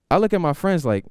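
ordinary delivery

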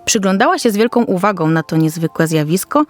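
usual speaking voice

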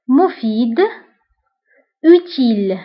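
ordinary talk

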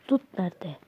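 ordinary talk, nothing marked

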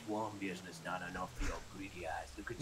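a menacing unvoiced whisper. Partner accuses the pizza owner of greed, in a menacing whisper. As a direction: hiss the accusation close in.

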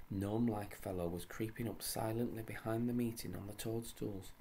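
ordinary delivery, nothing marked

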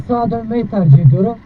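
normal talking voice

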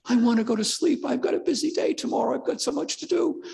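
high, whiny voice of the parent dragged out of bed; groggy parent. Retold or imitated. Preacher voicing a tired parent woken at night, high and whining. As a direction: complain like a sleepy parent who wants bed.

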